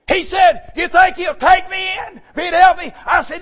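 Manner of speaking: high, loud preaching build; preaching at full force. Real, not acted. Preacher retells the park talk to his congregation, high and loud.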